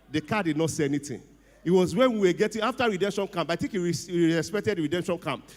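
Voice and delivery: ordinary delivery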